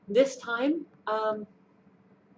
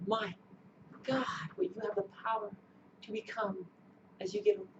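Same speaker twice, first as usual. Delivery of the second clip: awed whisper; 2 semitones lower, 9 dB quieter than usual. Real, not acted. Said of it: "awed whisper".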